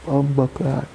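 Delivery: high shocked curse; shocked outburst; swearing in shock. Real, not acted. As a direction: blurt it out stunned. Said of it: "Excuse me language".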